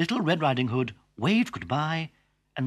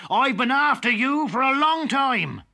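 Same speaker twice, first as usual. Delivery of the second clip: loud stern threat; furious hunter shouting; angry woodcutter; 13 semitones higher, 7 dB louder than usual. Acted. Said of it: "he cried".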